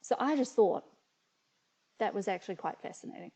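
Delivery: ordinary talk, nothing marked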